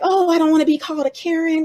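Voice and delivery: high-pitched mock worry; mock fretting. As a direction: imitate a fretful complainer, voice lifted high.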